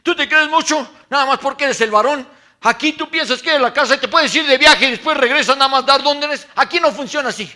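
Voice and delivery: high, loud indignant scolding; imitating the wife berating her husband, loud and shrill-pitched. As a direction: tear into him like an angry wife. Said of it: "me dijo".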